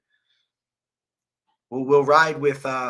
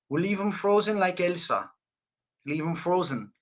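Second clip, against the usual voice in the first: lightly put-on fighter voice, pitched up; a light imitation of a fighter's threat; Israel Adesanya; 4 semitones higher than usual. Streamer trying the fighter's line, lightly imitated. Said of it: "Israel Adesanya impression, bro".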